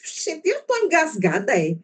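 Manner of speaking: high-pitched, fast imitation of a struggling student; high, hurried mock student complaint; exasperated student. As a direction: mimic a student stuck on a text, pitched high. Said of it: "a menina começou a ler, e daqui a pouco ela fez".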